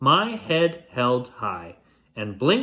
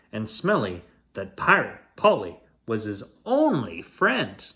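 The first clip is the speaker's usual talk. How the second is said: ordinary delivery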